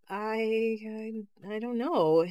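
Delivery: halting, repeating the first word; stuttering. A narrator's reading. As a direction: stumble over the start. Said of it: "Ida stuttered".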